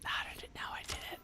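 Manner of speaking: hushed, fast whisper. Real, not acted. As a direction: breathe it out in disbelief. Whispered in shock.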